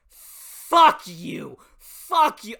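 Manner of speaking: loud retold yell at the characters; yelling curses. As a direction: shout it like you're back in the moment, enraged. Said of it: "I remember smiling and going".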